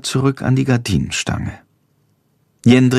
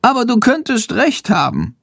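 high, musing bat voice; a small creature conceding a point; thoughtful little bat; 11 semitones higher than usual. A narrator's reading. Shandor admits Jendrik may be right, high and thoughtful.

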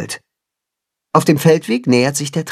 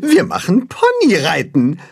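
high, happy cheer in a character voice; delighted; 8 semitones higher than usual. A narrator's reading. The ponies announce their attraction, high and delighted. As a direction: cheer with joy, pitched high. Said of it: "freuen sich".

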